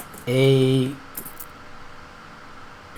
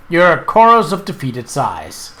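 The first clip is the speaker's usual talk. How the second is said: normal talking voice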